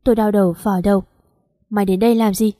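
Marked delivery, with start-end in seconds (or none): none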